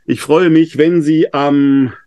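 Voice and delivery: ordinary talk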